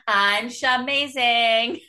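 mock a reality star showing off; hammed-up boast, syllables stretched; Jen. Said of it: "What was Jen's one as well?".

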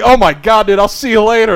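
high, loud embarrassed exclamation; flustered retreat. Retold or imitated. To the roommate he walked in on, flustered and appalled. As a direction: blurt it while backing out.

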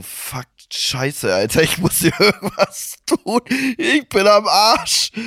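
rising, laughing mock despair, slowing at the end; panicked laughing curse. Himself googling pharmacy, laughing mock despair. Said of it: "ich so".

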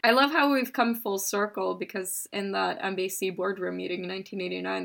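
usual speaking voice

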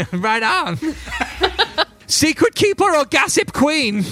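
high, loud, laughing quiz host; quirky NPC voice. Acted. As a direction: play the giggly shopkeeper firing questions. NPC quizzes party with questions.

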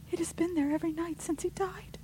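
a sister, scared, crying out the horror; frightened cry. A narrator's reading. Rebecca telling her sisters of the shadow, frightened. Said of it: "cried Rebecca".

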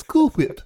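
high, hammed-up "Cool Whip" with breathy wh; squeaky cartoon baby voice, "Whip" aspirated; Stewie voice. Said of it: "And then Stewie says".

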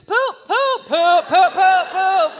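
loud chanting poop; drunk guys yelling. Retold or imitated. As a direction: holler it like rowdy drunks. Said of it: "these gentlemen were like".

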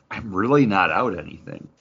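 usual speaking voice

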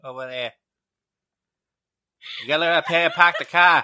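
hammed-up Boston shout, fast; Boston comic voice bellowing podca; Bill Burr Boston accent. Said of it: "get Bill Burr to do podcast".

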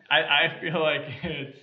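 laughing. Real, not acted. Laughing while trying to rate.

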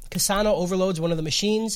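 ordinary talk, nothing marked

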